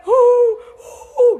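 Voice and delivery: high, loud imitated sobs; mock sobbing. Preacher imitating the creditor sobbing while hugging him. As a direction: sob out loud.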